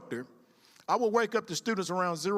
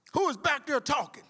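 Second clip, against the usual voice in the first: high pitched instructor yell, mock drill sergeant; retold drill yell; 10 semitones higher than usual. Instructor to the squad bay, high retold yell. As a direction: demand an answer like a drill instructor. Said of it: "but I yelled".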